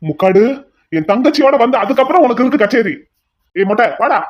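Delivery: menacing threat; angry threat. Acted. Threat to henchman.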